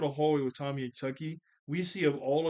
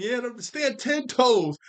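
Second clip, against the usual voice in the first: hype cheer; 9 semitones higher, 8 dB louder, much slower than usual. Real, not acted. Cheering Tommy on.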